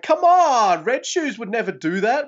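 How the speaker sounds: high, loud exasperated outburst; exasperated shout. Retold or imitated. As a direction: yell it at the ref in disbelief. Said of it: "and I'm like".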